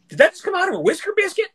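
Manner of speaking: put-on very high, fast Southern belle voice in a Carolina accent. Retelling a TikTok duet, mimics the Carolina Southern woman's question. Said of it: "she had the Carolina Southern accent".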